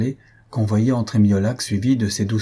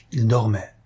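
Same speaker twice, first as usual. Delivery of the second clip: ordinary delivery, nothing marked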